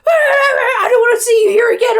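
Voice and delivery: high, distorted mock screech; hammed-up, very loud shrieking tirade; imitated screaming. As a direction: scream it like an overloaded phone mic. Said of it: "she just screamed".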